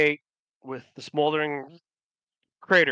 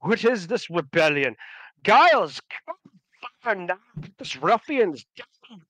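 high, blustering indignation; rally the guards in outrage; offended governor; 8 semitones higher, 4 dB louder than usual. Acted. Usurping governor calls on Giles against the rebels, offended bluster. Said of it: "the governor be offended".

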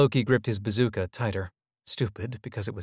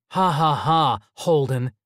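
sarcastic laugh; 5 semitones higher, 6 dB louder, slower than usual. A narrator's reading. Loki embarrassed by friends' laughter.

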